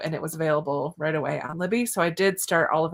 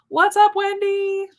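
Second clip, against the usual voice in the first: high, slow, drawn-out hello; singsong greeting; 12 semitones higher, 6 dB louder, slower than usual. Real, not acted. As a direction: sing the greeting up high and stretched. Greeting a chat viewer.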